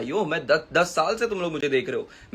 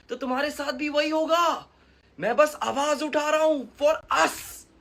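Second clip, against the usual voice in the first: high, drawn-out exasperated appeal; impassioned plea; 8 semitones higher, much slower than usual. Real, not acted. Creator tells hating fans he represents them, impassioned. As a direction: plead at the top of your pitch, stretching words. Pleads with hostile fans as fellow outsider.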